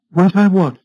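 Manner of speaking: high, loud, puzzled query; startled question. A narrator's reading. Wife caught off guard by her husband, startled. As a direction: come back sharply, pitch jumping.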